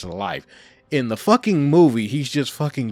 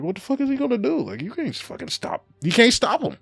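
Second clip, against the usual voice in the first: squeaky high amazed outburst; excited disbelief; 12 semitones higher than usual. Retold or imitated. Fan watching the show, high excited disbelief. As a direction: gasp it out in delighted disbelief. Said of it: "I'm like".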